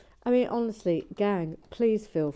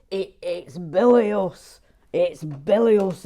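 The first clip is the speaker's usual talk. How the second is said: loud, slow drawn-out bilious; imitating Gary Blair; 13 dB louder, slower than usual. Mocking Gary Blair's verdict on the Green. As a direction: ham up his pompous bilious. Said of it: "That's how he talks".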